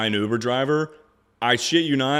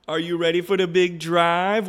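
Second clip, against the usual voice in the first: higher sing-song pet voice; pet talk; 7 semitones higher than usual. Real, not acted. Owner asking his dog about the drive, pet voice.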